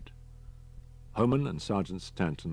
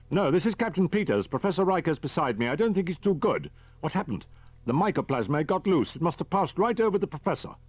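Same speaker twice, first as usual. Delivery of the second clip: captain voice; 12 semitones higher, 6 dB louder than usual. A narrator's reading. Captain Peters on radio.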